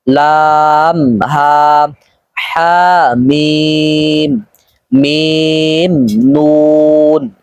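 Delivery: very slow, each letter name stretched; drawn-out letters. Shown as a demonstration. Teacher stretching letter names for students. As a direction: hold every vowel long and even. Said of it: "প্রত্যেকটি সংযুক্ত হরফকে টেনে টেনে পড়তে হবে".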